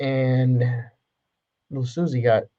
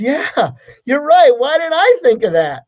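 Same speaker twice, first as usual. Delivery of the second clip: sobbing husband; 12 semitones higher, 5 dB louder than usual. Retold or imitated. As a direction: whimper it like a man at his wits' end. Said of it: "He says".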